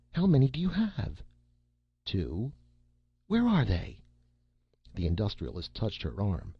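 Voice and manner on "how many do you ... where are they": high, loud alarmed questions; a mother's rising panic; anxious mother demanding answers. Acted.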